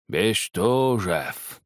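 put-on slow old man's mumble, drawing the name out; toothless old man mumble. A narrator's reading. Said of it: "прошамкал старик".

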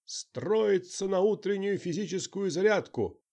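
barked parade-ground order, high and loud; shouting drill sergeant. Acted. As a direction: bellow it down the barracks. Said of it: "несётся вслед".

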